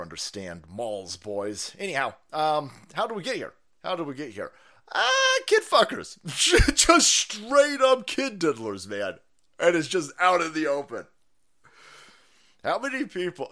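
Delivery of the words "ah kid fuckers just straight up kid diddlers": mock shriek. Real, not acted. Mocking exclamation.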